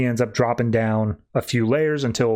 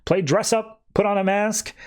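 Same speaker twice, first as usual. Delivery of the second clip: high, raised mock scolding; hammed-up angry rant; pissed off; 9 semitones higher than usual. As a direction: berate the villain, pitched up. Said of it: "Batman gets all pissed off".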